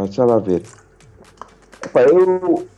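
ordinary talk, nothing marked

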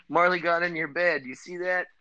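dad showing his daughter the dog on the bed, amused. Real, not acted. Dad talking to daughter about dog.

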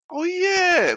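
high squeaky oh of realisation; realising the coffee was free all along, high squeal; dawning realisation. Retold or imitated. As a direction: let it slide up into a high squeak.

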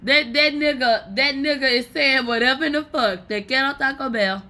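loud, high mockery with a laugh; loud mocking. Real, not acted. Vlogger laughing at the man saying mamita, loud mocking. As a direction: hoot at him loudly.

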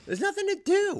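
high, loud complaint; exasperated outburst. Real, not acted. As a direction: burst out in frustration. Exasperated rant about sitting around.

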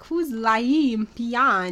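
slow, mangled mock-Swedish question; drawn-out fake Swedish accent. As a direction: imitate a puzzled Swede sounding out the name. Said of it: "They're like".

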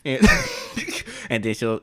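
ordinary delivery

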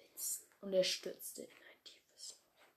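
tense storyteller's whisper; hushed whisper. A narrator's reading. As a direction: drop into a dramatic whisper. Dramatic fall into a hole.

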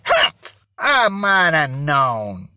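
low, slow grumble; huff, then draw it out low; disgruntled huff. A narrator's reading. Aunt finding it was only her nephews, huffy. Embarrassed aunt huffs at the boys.

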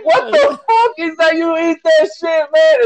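high-pitched disbelieving Italian friend; Italian guy. Retold or imitated. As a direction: squeal it, outraged at the silverware.

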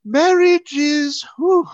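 high, loud, drawn-out musing; exaggerated, slow impression of a TV character; imitated character. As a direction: stretch it out in a hammed-up imitation. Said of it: "she's kinda like".

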